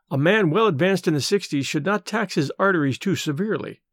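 plain reading voice

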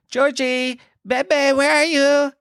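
high, drawn-out call for a lost cat; cat-calling baby talk. Retold or imitated. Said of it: "I hate how I talk to my cat".

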